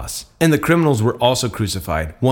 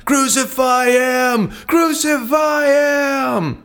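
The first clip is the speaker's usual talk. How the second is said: very high, slow, stretched-out shout; mob shouting; 12 semitones higher, much slower than usual. A narrator's reading. The mob shouts at Pilate to crucify Jesus, a long high shout. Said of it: "they kept shouting".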